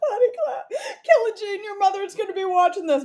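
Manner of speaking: high, breaking with laughter; real laughing fit, voice high; laughing hard. Real, not acted. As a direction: scold her through helpless laughs. Laughing while scolding friend.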